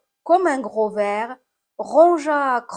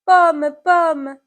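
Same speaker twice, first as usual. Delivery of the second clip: loud, higher call to the apple; 6 semitones higher, 9 dB louder than usual. Acted. Someone calls to the fallen apple, calling out. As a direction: call out with alarm, pitch raised.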